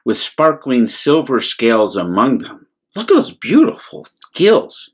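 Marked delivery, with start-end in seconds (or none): 2.9-4.9 delighted aside. Real, not acted. Reader showing a child the page with delight. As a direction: point out the picture with delight.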